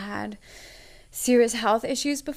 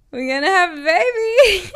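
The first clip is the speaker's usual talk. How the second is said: louder and higher, laughing through happy tears; laughing and crying; 9 semitones higher, 10 dB louder than usual. Retold or imitated. Telling her partner about the baby, laughing through tears. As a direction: blurt it out half laughing, half crying.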